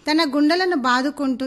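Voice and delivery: ordinary delivery, nothing marked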